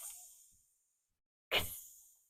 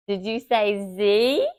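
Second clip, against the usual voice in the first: ordinary talk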